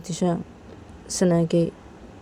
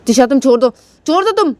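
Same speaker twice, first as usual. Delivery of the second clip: louder, higher call to let go; urgent shout; 6 semitones higher, 6 dB louder than usual. Retold or imitated. Anaya telling Tisha to let go, shouting. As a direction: pull her off, shouting. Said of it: "Shanaya से बोलती है".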